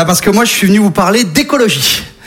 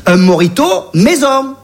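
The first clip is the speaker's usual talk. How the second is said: put-on Marseille accent; 2 semitones higher than usual. Polar bear with a Marseille accent offering the journalist a mojito. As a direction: shrug off the doom in a thick Marseille accent. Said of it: "l'accent marseillais".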